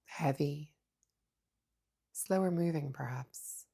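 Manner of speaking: soft, unhurried meditation voice with long pauses; hushed, calm mindfulness guidance; guided meditation. Acted. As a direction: guide them gently inward, leaving space between phrases.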